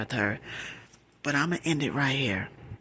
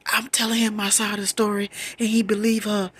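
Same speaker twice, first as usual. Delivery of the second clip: higher, louder upset outpouring; acted distress; 6 semitones higher, 3 dB louder than usual. Explaining to family that boyfriend believed her cousin, acted distress. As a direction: blurt out the injustice. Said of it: "I am having a panic attack".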